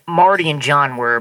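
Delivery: normal talking voice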